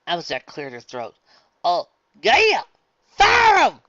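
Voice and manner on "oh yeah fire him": high, loud eager yell. Acted. Applejack cheering to fire the umpire, loud yell. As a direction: yell it with gusto.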